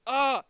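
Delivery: loud exasperated groan; frustrated groan. Retold or imitated. Finally fed up with the whole trip, loud groan. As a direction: let out a burst of frustration.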